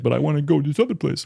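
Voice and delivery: put-on petulant protest; mock upset protest. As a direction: mimic an upset friend pleading for his way. Imitating upset friend wanting another stop.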